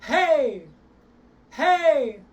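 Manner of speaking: high loud hey pushed from the belly. Shown as a demonstration. Voice coach demonstrates a pushed hey for students. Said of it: "Try hey".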